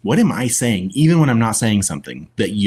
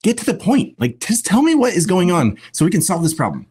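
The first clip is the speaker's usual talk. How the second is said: much higher mock-impatient husband; impatient man; 11 semitones higher than usual. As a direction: hurry her up, hammed-up. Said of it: "men are the ones who just".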